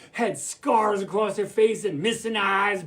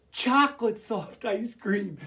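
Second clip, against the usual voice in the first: usual speaking voice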